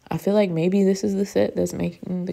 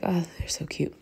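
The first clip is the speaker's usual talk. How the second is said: whispered quote; 8 dB quieter than usual. Retold or imitated. Quoting herself admiring nails.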